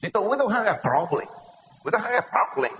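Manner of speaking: put-on high-pitched protest in an Israeli accent; imitated Israeli accent. Israeli colleagues deny any problem, imitated Israeli accent. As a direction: mimic an indignant Israeli-accented denial. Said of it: "Los de Israel".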